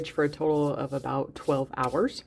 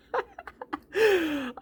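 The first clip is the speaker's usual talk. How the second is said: delighted laugh. Real, not acted. First look at the bread.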